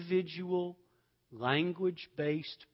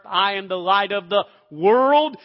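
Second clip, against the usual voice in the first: bold confession; 6 semitones higher, 15 dB louder than usual. Shown as a demonstration. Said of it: "I confess it openly".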